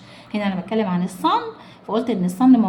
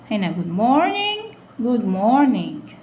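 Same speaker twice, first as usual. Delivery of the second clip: very slow reading; much slower than usual. Shown as a demonstration. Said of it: "slow drawn-out model reading".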